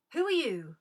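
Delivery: ordinary talk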